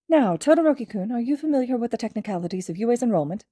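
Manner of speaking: a courteous, gently cheerful headmaster; Nezu's voice. Acted. Nezu questions Todoroki.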